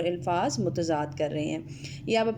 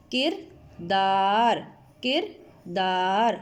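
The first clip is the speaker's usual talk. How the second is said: کردار stretched slowly, each sound clear; slow modelled word; 2 semitones lower, much slower than usual. Shown as a demonstration. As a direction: spell it out slowly for the class. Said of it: "کاف زیر کی".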